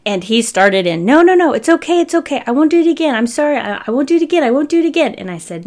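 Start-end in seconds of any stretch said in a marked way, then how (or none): 1.1-5.1 put-on high, loud, panicky pleading child; pleading kid. Nephew pleading with his uncle to avoid timeout, panicky high voice. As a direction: beg like a caught five-year-old.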